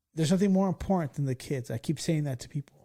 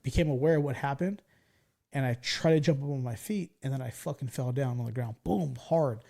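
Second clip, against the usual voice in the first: usual speaking voice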